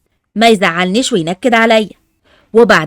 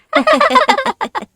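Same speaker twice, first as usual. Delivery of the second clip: high burst of laughter. Acted. Laughing at Ali's story.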